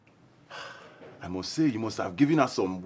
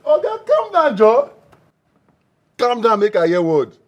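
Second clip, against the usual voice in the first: excited; 8 semitones higher, 9 dB louder than usual. Acted. Excited friend demanding the story.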